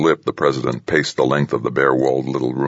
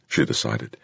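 ordinary delivery, nothing marked

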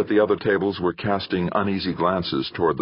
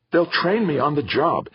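eager, higher and brighter in pitch; enthusiastic; 10 semitones higher than usual. Acted. Son pitching his new job to his parents, enthusiastic. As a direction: sell it, full of excitement. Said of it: "Theo went on enthusiastically".

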